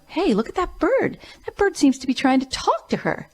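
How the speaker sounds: high, amazed stranger pointing at a bird; excited bystander. Retold or imitated. Stranger at the zoo marvels to partner, high excited exclaim. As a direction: call out to your partner in surprise.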